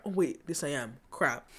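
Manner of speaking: normal talking voice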